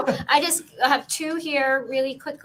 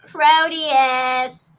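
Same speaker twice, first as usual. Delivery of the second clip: shouting; 3 semitones higher, 8 dB louder than usual. Retold or imitated. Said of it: "I went".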